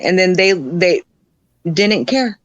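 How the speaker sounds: usual speaking voice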